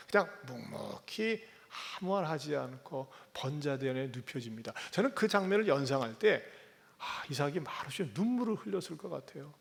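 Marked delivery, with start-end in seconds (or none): none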